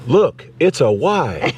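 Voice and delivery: quoting a film line with a hint of character voice; movie line quote. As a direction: toss off a famous movie line. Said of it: "tell me what movie that came from".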